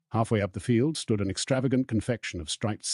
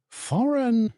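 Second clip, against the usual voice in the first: high, baffled repeat of the word; Mister Weasley, puzzled, repeating the question; puzzled echo; 5 dB louder than usual. Acted. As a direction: bounce it back in confusion. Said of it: "repeated Mister Weasley, puzzled".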